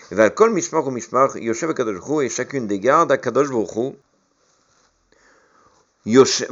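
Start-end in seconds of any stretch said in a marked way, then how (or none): none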